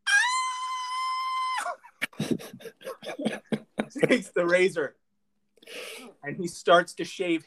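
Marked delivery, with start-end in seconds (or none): 0.1-1.8 very high, sustained shriek; drawn-out cartoon wail; long mock scream. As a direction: hold a high scream of acted pain. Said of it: "the whole time he's going".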